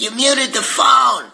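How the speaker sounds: hammed-up girlfriend scolding him; girlfriend's retort. Girlfriend telling him he muted the phone, mock shout. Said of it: "She's like".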